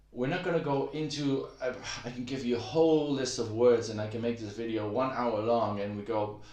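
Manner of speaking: ordinary delivery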